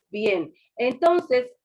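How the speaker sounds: ordinary delivery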